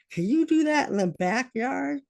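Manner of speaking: high, strained asking; anxious plea. Retold or imitated. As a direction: beg him, pitch climbing high. Mother asks son to move outside.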